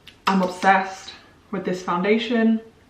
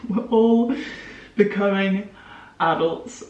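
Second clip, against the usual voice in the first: laughing; 2 semitones higher, slower than usual. Shown as a demonstration. To her viewers, half-panicked about adulthood: laughing repeat. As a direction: laugh at the dawning truth. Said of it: "shit, we're all becoming adults".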